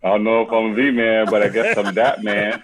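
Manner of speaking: loud laughing reply. Real, not acted. Guest laughing off the Mister Man joke, laughing. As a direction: laugh through the words.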